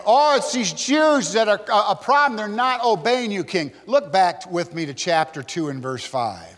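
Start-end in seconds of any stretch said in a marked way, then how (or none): 0.1-3.7 higher-pitched mock tattling to the king; tattling accusers. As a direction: act out informers reporting to their ruler. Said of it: "are going and saying".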